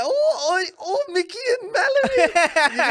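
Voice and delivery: high, slow, squealing fan voice half laughing. Retold or imitated. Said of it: "everyone's like".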